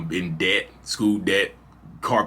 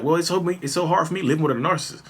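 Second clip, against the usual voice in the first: a touch of the wife, pitched higher and quicker; wife's retort; 9 semitones higher than usual. Retold or imitated. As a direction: fire the jab back, high and fast.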